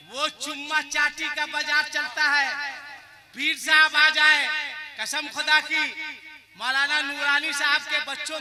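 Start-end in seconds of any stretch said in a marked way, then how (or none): none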